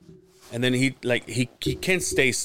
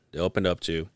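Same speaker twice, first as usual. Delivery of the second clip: usual speaking voice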